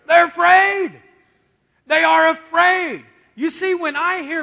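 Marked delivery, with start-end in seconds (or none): 0.1-3.1 loud, high preacher's punch; booming answer to the crowd; emphatic shout. Real, not acted. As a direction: drive the point home at full voice.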